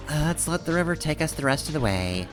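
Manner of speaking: ordinary talk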